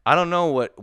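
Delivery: high, loud exasperated burst; fed-up rant peaking loud; indignant outburst. Real, not acted. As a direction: shout it in disbelief. Ranting about cooler prices.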